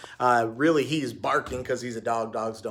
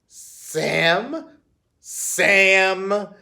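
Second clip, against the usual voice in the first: suspicious; 5 semitones higher, 7 dB louder than usual. A narrator's reading. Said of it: "repeated Missus Nutbush, suspicious".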